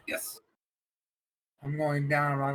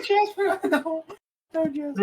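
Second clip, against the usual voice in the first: high-pitched mock lament; mock wailing; slower than usual. Player pleads for Jasper in danger, mock wailing. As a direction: wail the name in hammed-up despair.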